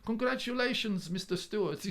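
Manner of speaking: put-on Polish accent. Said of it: "I keep doing the Polish accent".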